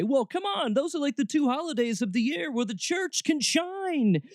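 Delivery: high-pitched, pleading churchgoer voice; mock church defender. Host voicing church folk defending holidays, mock pleading. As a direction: keep it high and insistent throughout, mimicking an excuse. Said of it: "easy for us to be able to say".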